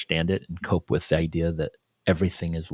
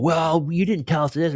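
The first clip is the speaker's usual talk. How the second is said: mock yell; 9 semitones higher than usual. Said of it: "if you start yelling at people".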